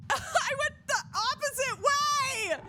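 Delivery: very high, slow laughing wail; laugh-crying. Real, not acted. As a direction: wail through real laughter. Laughing despair.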